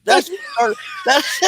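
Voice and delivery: helpless laughing fit, tears streaming, trying to talk through it; crying with laughter. Real, not acted. Host apologizing to viewers while crying with laughter. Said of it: "no way I can stay on the lines laughing like this".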